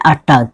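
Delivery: "अटैक" ending in -tak, not -taik; taught pronunciation "अटाक". Shown as a demonstration. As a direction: say "अटैक" as at-TAAK, with an "a" vowel. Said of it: "क्या बोलना है".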